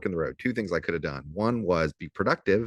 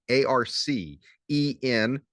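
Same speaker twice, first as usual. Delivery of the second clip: slow spelling out; 6 semitones higher, slower than usual. Shown as a demonstration. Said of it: "Spell it correctly".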